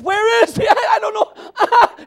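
acting out a woman crying out, high and loud; anguished high wail from the pulpit; tearful cry. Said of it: "She started to cry".

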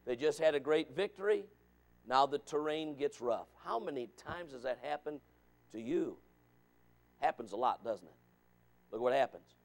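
normal talking voice

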